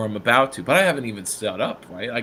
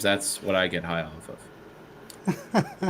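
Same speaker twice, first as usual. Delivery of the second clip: dry wit; 3 semitones lower, 6 dB quieter, much slower than usual. Retold or imitated. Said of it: "the dry wit".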